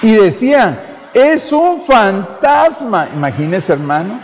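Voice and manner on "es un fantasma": high, slow, wide-eyed ghost cry; frightened cry. Acted. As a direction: gasp it out like terrified disciples. Discípulos aterrados ven al Señor. (grito asustado de los discípulos)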